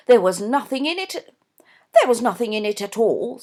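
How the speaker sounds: loud, insistent mother; emphatic Mrs Norbury. A narrator's reading. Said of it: "said Miss Norbury's mother emphatically".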